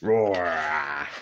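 loud, drawn-out mock roar; long playful growling roar; pretend dinosaur roar. A narrator's reading. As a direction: roar like a toy monster. Playing at scary dinosaurs.

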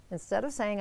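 ordinary delivery

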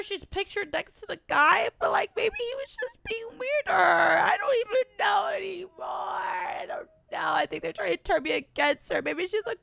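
high, loud, half-crying shout; falling apart in fear; panicked crying. Real, not acted. Streamer panicking over whom to call, crying and shouting.

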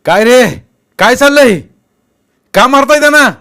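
very loud, high yell; stern questions at full shout; shouted scolding. A narrator's reading. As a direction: bellow at boys caught fighting. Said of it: "हे बघून भगवानराव ओरडले".

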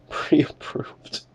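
a quick amused whisper of "Pre-approved"; laughing whisper. Real, not acted. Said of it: "Dude, this is hilarious".

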